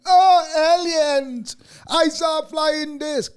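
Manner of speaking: high, hammed-up gushing about a flying disc; mock alien excitement. Mocking UFO believers, high and hammed-up excited. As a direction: squeal it like a gullible UFO fan.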